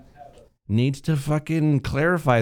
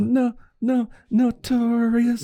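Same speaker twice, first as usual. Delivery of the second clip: high mock protest; 13 semitones higher, slower than usual.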